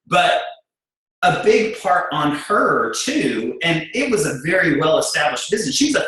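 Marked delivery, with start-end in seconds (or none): none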